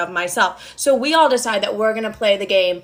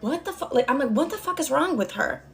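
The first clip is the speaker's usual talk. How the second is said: hushed disbelief; 4 semitones higher, 5 dB quieter than usual. Retold or imitated. Confused whisper at table.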